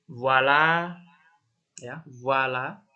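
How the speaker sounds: "voilà" as "vwala", loud and drawn out. Shown as a demonstration. Teacher reading French voilà aloud, emphatic demo. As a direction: voice it clearly, "vuala", stretched. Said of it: "Itu cara bacanya voilà".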